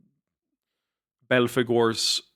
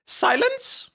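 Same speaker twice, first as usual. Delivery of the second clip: much higher, slow, squeaky mock command; high squeaky try. Shown as a demonstration. Streamer testing a squeaky voice for the young master. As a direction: try the order as a squeaky falsetto. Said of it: "I can't do that voice".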